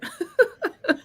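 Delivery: high emotional laugh; tearful laugh. Real, not acted. Host handing over to guest, laughing through emotion. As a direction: laugh while pulling yourself together. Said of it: "get myself together over here".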